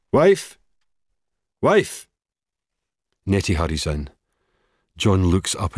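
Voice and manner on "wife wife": loud, high shout for his wife. A narrator's reading. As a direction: yell for her from the next room. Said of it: "John shouts".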